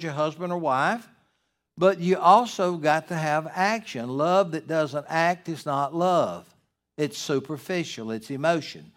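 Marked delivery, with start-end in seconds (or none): none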